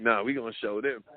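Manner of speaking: a hint of a boastful street tough; cocky ego voice. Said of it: "your ego keep being like".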